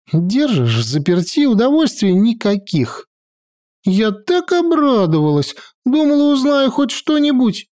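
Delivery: put-on high, lively girl voice; sulky teenage granddaughter; young girl voice. A narrator's reading.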